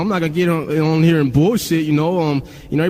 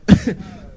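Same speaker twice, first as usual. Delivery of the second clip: real high-pitched laugh; burst of laughter; 7 semitones higher, 4 dB louder than usual. Real, not acted. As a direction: crack up helplessly. Cracks up at her imitation.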